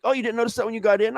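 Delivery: high and quick, offhand; high casual voice. Retold or imitated. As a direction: toss it off high and fast. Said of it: "He's like".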